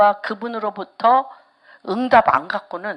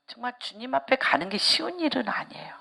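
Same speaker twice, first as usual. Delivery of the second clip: much softer whispered confession; hushed whisper; 10 dB quieter, faster than usual. Real, not acted. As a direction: whisper it as a solemn truth. Solemn hushed point. (엄숙하게 속삭이며)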